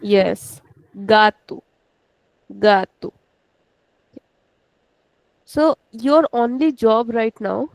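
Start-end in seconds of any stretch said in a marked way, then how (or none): 1.1-3.1 gatu with GA stretched and tu short; stress on first syllable. Shown as a demonstration. Teacher repeats gatu for students, stressing the first syllable. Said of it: "We put stress on the this one".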